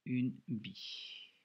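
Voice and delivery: each sound drawn out slowly as it is written; dragged-out une bille; slow dictation. Shown as a demonstration. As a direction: spell it out slowly while writing. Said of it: "je me corrige tout de suite".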